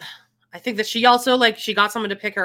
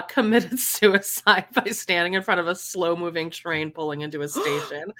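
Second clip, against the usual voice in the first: ordinary talk, nothing marked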